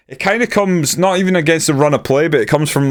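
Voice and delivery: post-goal buzz. Real, not acted. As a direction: still riding the goal high. Excited after goal scored.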